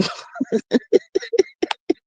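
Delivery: bursts into a high-pitched laugh. Real, not acted. Laughing at a viewer's joke, high burst of laughter. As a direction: cracks up at the comment.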